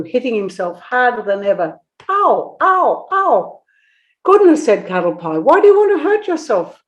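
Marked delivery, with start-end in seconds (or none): 2.1-3.6 high, loud ow cries; pained yelps. Acted. Kangaroo hitting his own head. 4.2-4.6 high, loud gasp of surprise; surprised. A narrator's reading. Startled at the kangaroo. 5.4-6.8 high, fast astonished question. A narrator's reading. Startled at the kangaroo.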